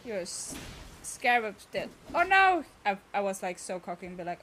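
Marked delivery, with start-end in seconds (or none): 2.1-2.6 loud, high cry of dismay; sudden alarmed exclamation; dismayed outcry. Real, not acted. Game mishap after cocky remark.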